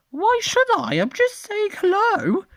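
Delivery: very high squawky innocent protest; high vulture voice. Acted. Rex the vulture answering Noah, very high squawky protest.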